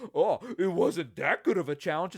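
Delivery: snide fan voice with a laugh; mock voice of the fan in the seat. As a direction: imitate the smug neighbour.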